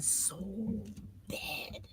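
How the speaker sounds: breathy whisper on bad. Real, not acted. Host telling guest how badly he was beaten, whispered. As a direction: let the word drop to a whisper. Hushed word about the beating.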